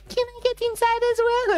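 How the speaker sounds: very high, squeaky little animal voice; pleading fox voice. Acted. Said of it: "and she was like".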